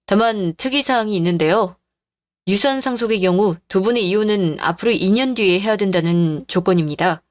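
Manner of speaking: usual speaking voice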